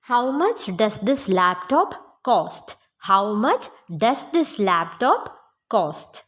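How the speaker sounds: slow, measured question for learners; slow model sentence. Shown as a demonstration. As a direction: say it slowly, phrase by phrase. Teacher models English question slowly.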